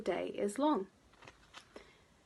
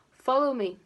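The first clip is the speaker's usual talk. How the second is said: louder, cheery call; 4 semitones higher, 7 dB louder than usual. A narrator's reading. Mister Happy calls to Mister Miserable in the wood, calling out. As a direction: call him on through the wood.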